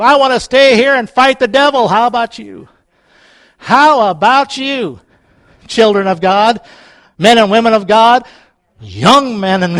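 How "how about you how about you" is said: normal talking voice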